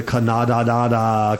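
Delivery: "Canada" as ka-NAH-da-da, syllables drawn out; mock mispronunciation. Shown as a demonstration. Host stumbling over a headline, "Canada" mispronounced ka-NAH-da-da. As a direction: fumble "Canada" slowly with broad ah sounds. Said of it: "how do you pronounce".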